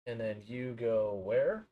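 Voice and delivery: muffled, off-mic and distant; talking away from the mic. Real, not acted. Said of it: "I do apologize for it being a little bit muffled".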